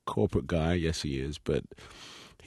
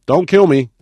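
much higher, loud hammed-up plea; mock plea; 10 semitones higher, 15 dB louder than usual. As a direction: play the scared victim over the top. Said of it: "that one's good".

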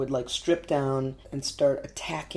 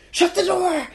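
sudden, much higher and louder panicked shout; terrified cry; 8 dB louder than usual. Retold or imitated. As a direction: yell it like the danger is right outside the cab. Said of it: "said in this super terrified voice".

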